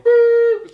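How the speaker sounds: hang-up boop. Retold or imitated. Imitated phone hanging up.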